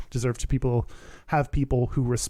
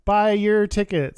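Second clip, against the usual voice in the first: slow, high, drawn-out plug; hammy announcement; 6 semitones higher, 4 dB louder, slower than usual. Pretend. Podcast host plugging tickets to listeners, hammy call-out. Hosts hammily plug live shows.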